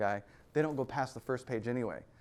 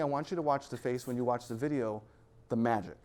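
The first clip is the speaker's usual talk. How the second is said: ordinary talk, nothing marked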